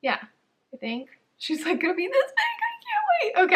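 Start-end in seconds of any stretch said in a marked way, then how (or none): 1.4-3.5 much higher, sing-song cutesy voice. Real, not acted. Vlogger cooing to viewers about the tiny new puppy. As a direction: coo over something tiny.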